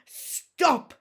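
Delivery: loud sudden yell. A narrator's reading. Hiccup yelling at the dragon wrecking the mattress. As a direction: shout it at the dragon. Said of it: "yelled Hiccup".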